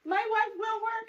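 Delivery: mimicking son. Mother imitating son's declaration, mock proud. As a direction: puff up like a proud little boy. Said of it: "moms don't have to just be".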